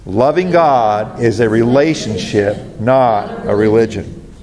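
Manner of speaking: slow, deliberate recital for the congregation to echo; measured, slowed-down unison line; slow call and response. Shown as a demonstration. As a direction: spell it out slowly so the church can say it along. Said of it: "Say that with me".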